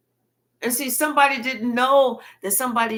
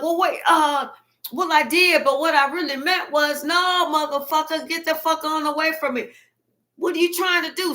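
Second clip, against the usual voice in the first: flustered liar; 6 semitones higher, 4 dB louder than usual. Retold or imitated. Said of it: "the liar was like".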